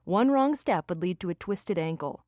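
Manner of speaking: usual speaking voice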